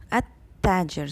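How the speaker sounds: "at tajir" with no l, a held double t; lam assimilated. Shown as a demonstration. Teacher models the sun letter ta, lam silent. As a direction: run "at" straight into "tajir", l silent. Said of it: "So the lam is not".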